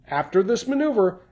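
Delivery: normal talking voice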